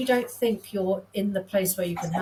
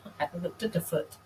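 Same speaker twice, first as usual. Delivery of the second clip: soft, laughing through the words; rueful laugh; 6 dB quieter than usual. Real, not acted. Nurse owning up to a missed diagnosis, laughing softly. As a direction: laugh at yourself while you admit it. Admitting her old mistake.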